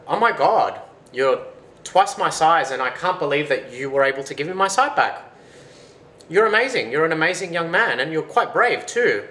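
high, loud astonishment; amazed gushing. Acted. Giant thanks the young man who restored his sight, amazed gushing. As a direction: gush with wonder and praise.

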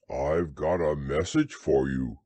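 low bear voice; deep, simple storybook bear; Pooh. A narrator's reading. Bear brings Rabbit a message, low voice.

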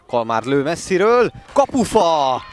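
loud, high call of the shot; excited sports commentary. Real, not acted. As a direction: shout "kapufa!" at the post. Shot hits the post.

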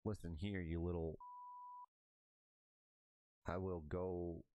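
loud, slow growling threat; demon voice. Acted. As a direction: menace them like a demon. Menacing villain threats.

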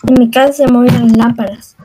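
low and fast, run together; rapid low-pitched delivery; quick repeat. Real, not acted. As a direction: rattle it off in one low breath. Quick repeat of slow sentence.

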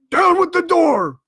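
loud, high shouted order; cried command. A narrator's reading. Blind man orders his men to the door, shouting. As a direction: bellow it to your men. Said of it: "he cried".

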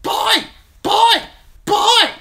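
very high, loud bird squawks; shrilly laughing raven. A narrator's reading. Raven echoes its master's word, shrill mocking squawks. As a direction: screech each word separately like a jeering bird. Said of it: "The raven laughed shrilly".

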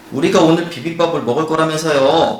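half-acted grumble at the cook; lightly voiced upset kid; protesting student. Voicing upset student, translated.

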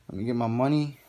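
usual speaking voice

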